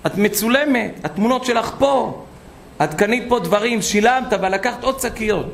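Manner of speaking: high-pitched accusation of theft; accusing shout. Retold or imitated. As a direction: call her a thief in front of the shop. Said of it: "צעקה עליה".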